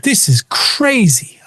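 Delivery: high, drawn-out disbelief; slow, high-pitched incredulous quote; retold amazement. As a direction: act out being amazed by a coincidence.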